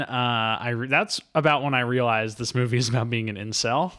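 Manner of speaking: ordinary delivery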